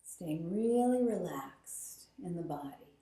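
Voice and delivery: guided meditation. Acted. Guided breath count.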